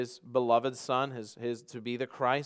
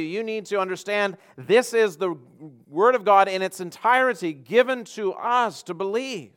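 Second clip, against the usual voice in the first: raised, forceful sermon delivery; emphatic preaching; 8 semitones higher, 9 dB louder than usual. Real, not acted. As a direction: drive each point home to a congregation. Exhorts the congregation.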